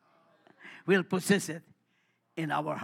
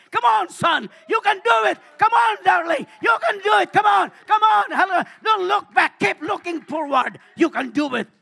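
cheering shout; 8 semitones higher, 11 dB louder than usual. Acted.

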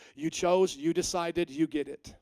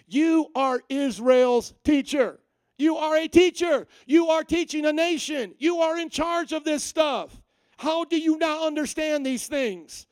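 higher, louder, slow exasperated scolding; sassy rebuke; 8 semitones higher, 5 dB louder, slower than usual. Retold or imitated. Jesus scolding Nicodemus the teacher, slow and exasperated. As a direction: lean in, scold the teacher slowly. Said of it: "get a little sassy with them".

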